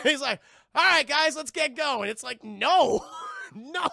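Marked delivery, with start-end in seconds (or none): 0.7-2.1 loud, raised mock-leader voice; hammed-up, loud and oblivious; brisk rallying call. Acting leader rallying stunned friends. 2.4-3.9 loud, drawn-out mock "no"; hammed-up disbelief; incredulous refusal. Acting friends' incredulous refusal.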